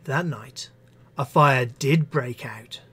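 ordinary talk, nothing marked

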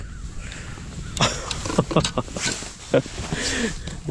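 long, soft, airy laughter; helpless laughing fit; disbelieving laugh. Real, not acted. Angler netting thick bluegills, laughing in disbelief. Laughs over huge fish.

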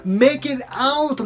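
very slow phrase; slow model phrase; slow teaching read. Shown as a demonstration. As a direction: stretch every word. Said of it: "なんとか外に出る".

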